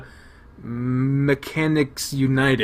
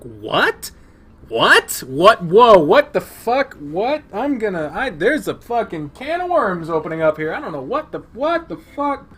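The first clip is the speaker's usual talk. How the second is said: higher, louder, piling up disbelief; stunned repeated what; 7 semitones higher, 5 dB louder than usual. Real, not acted. Player hearing about the fake cheating joke, loud stunned disbelief. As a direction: keep blurting out what.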